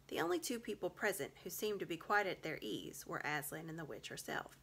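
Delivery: ordinary delivery, nothing marked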